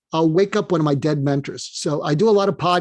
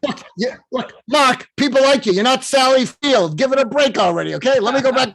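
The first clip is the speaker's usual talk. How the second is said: high, impatient Brooklyn accent impression; Larry King; 8 semitones higher, 3 dB louder than usual. Dead mentor reassuring Mark, exasperated Brooklyn accent. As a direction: reassure him gruffly in comic Brooklynese. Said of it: "in his Brooklyn accent".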